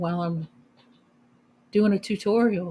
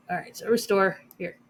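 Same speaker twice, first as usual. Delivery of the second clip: ordinary talk, nothing marked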